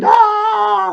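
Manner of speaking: loud, high-pitched imitated scream; long high "Ah!" in a mimicked shriek; mock scream.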